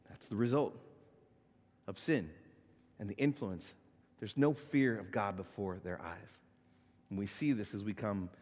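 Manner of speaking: ordinary delivery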